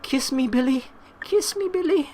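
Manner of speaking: very high put-on girl voice; imagined girl pleading. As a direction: squeak it as a mock-romantic plea. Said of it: "she's there like".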